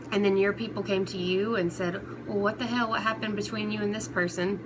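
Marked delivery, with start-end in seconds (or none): none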